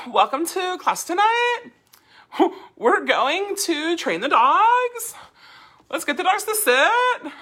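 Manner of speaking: mocking uptalk, very high on TONIGHT, DOGS, SIT; slow, loud, exaggerated rising endings on TONIGHT, DOGS, SIT; everything a question. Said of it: "that everything's a question".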